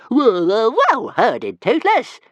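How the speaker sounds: a tiny dragon sure he is doing good; gleeful little dragon. Acted. Dragon praising himself.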